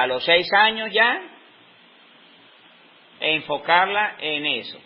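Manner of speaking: ordinary talk, nothing marked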